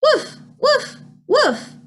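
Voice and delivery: very high, short woofs; high-pitched storybook barks; dog woof. A narrator's reading. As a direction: imitate a small dog barking. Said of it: "is the sound of a dog".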